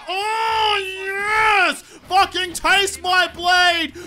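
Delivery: high, loud, drawn-out roar of triumph; gloating battle shout. Real, not acted. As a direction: bellow it at your foe, stretching the words. Gloating shouts during a game fight.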